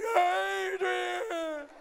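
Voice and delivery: long drawn-out mock wail; Rocky. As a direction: bawl the name like a heartbroken boxer. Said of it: "And Rocky goes".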